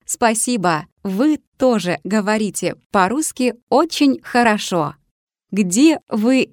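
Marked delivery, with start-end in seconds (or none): none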